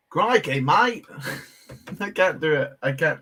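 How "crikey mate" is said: badly faked Aussie accent, higher pitch; amateur Aussie accent with a rising finish; Australian accent. Shown as a demonstration. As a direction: attempt a cartoon Australian, lilting up at the end. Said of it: "my best Australian accent".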